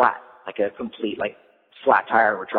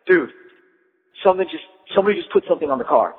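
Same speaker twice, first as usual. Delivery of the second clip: higher, fast, alarmed blurt; mock panic; 7 semitones higher, 4 dB louder, faster than usual. Skater warning his van mates, panicked and fast. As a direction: sell the panic like a straight-faced prank. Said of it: "he's kinda, like, panicking".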